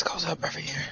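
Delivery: whispered aside; whispering. Real, not acted. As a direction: whisper it under your breath. Aside during the argument.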